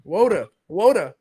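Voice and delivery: "water" mimicked as "WOH-tuh"; imitating caller's pronunciation. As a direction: echo "water" with a long oh in the first syllable. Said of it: "He said water".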